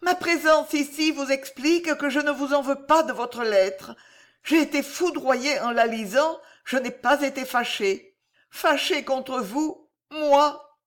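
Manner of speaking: high, earnest voice of a wounded lover; high, fervent heroine, ending on an indignant exclamation; impassioned woman in love. A narrator's reading. Voices Corisandre in the story.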